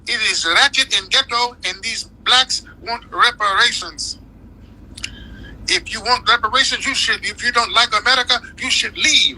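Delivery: high mocking voice, pitched well up; mock high-pitched impression of a snooty critic; mocking the Colombian woman. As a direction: parody her scornful rant. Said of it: "talking about Juneteenth ain't shit".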